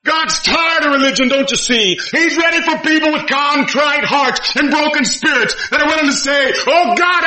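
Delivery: high, loud pleading preacher; fervent preaching. Acted. Preacher voicing a sinner's prayer to God, fervent and high.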